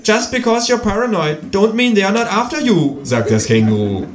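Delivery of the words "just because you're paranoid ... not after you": high kangaroo voice in English; high-pitched, declaiming kangaroo; quoting kangaroo. Acted. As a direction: proclaim the quote in a high character voice. Kangaroo figure quotes in English.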